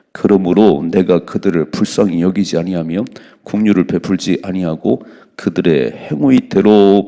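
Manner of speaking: loud, slow, solemn reading; scripture reading. Acted. Preacher reading the verse loudly to his congregation. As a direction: proclaim the verse slowly and heavily.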